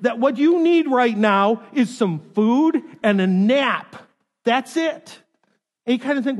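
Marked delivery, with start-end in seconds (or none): 0.0-5.2 emphatic verdict. Acted. Emphatic parental verdict to cranky child.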